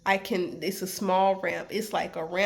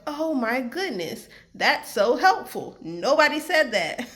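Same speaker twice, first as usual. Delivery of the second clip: higher, drawn-out gushing thanks; grateful relief; 4 semitones higher, 5 dB louder than usual. Retold or imitated. Lost traveler thanks a helpful stranger, gushing with relief. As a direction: gush with amazed relief, louder as it goes.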